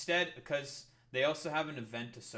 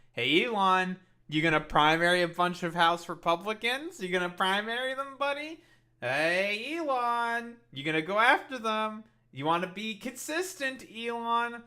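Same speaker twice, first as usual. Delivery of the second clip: mocking call-out, higher and louder; taunting; 9 semitones higher, 10 dB louder than usual. Taunting Elon over primarying Republicans, loud mock call-out. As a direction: needle him like a smug heckler.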